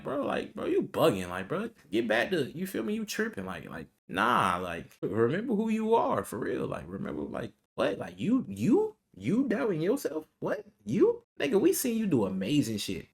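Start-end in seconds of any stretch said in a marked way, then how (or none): 0.0-3.5 high, incredulous buddy voice; disbelieving homie voice; homies calling him out. Retold or imitated. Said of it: "sometimes the homies like". 5.0-13.1 high, disbelieving buddy voice; stunned friend asking if you really doubt yourself; homies calling him out. Retold or imitated. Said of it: "sometimes the homies like".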